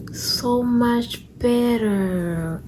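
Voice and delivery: long, high sigh of relief; blissful relief. Real, not acted. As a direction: stretch it out high and slow, relieved. Relief after removing tight bra.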